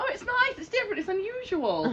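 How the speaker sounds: high, sing-song Australian voice; Australian accent. Retold or imitated. Mocking a polite compliment, high Australian accent. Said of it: "You've gotta do an Australian accent".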